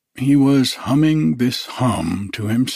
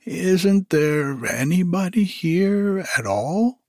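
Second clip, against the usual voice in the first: higher puzzled bear; Pooh voice; 8 semitones higher than usual. Acted. Pooh asks into the hole, puzzled bear voice. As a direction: ask it baffled.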